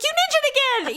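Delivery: high-pitched mock complaint; exasperated husband. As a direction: lightly imitate an exasperated husband. Retells husband's playful scolding.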